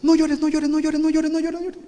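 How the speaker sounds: put-on high, urgent kid's plea; pleading kid. Begging his little brother not to cry, high and urgent. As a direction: hush him up in a high, pleading voice.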